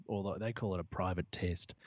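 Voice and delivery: mock-important low voice; deep voice. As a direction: drop low for effect. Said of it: "Why do you do the deep voice".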